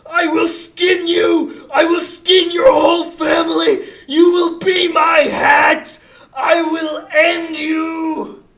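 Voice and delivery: loud, slow, half-laughing shouted threats; screamed threats. Retold or imitated. Scout chasing a raccoon with a knife, slow laughing shouted threats. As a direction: bellow it at the raccoon like a madman. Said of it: "and screaming".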